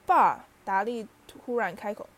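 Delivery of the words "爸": put-on a boy yelling for his father; sudden loud call. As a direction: blurt out a loud, urgent "Dad!". Dudley suddenly cries out. (达利突然大声喊爸)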